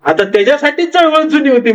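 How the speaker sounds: normal talking voice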